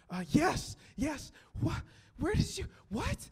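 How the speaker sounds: high, loud, breathless mock shock, rising over the lines; imitating the fiancée's stunned, high-pitched yes; stunned disbelief. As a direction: squeal it like you can't believe the ring is real. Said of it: "It was hilarious".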